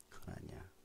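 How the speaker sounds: soft unvoiced whisper; a hushed whisper of disapproval. Real, not acted. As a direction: breathe it barely audible. Pastor whispers a correction.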